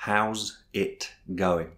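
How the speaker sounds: very slow, word by word; drawn-out teaching demo, evenly separated; slow pronunciation model. Shown as a demonstration. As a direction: space out each word for learners. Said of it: "pratiquer la prononciation avec vous".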